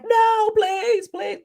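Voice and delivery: high pleading cry, hammed up; mock begging. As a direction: mimic a desperate plea, mock-pleading. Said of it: "did you think I was getting ready to be like".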